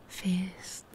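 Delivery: usual speaking voice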